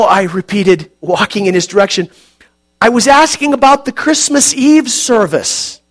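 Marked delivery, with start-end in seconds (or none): 2.8-5.8 louder, higher, patient; raised voice. Acted. Narrator repeats his question loudly to the old man. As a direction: speak up clearly to be heard.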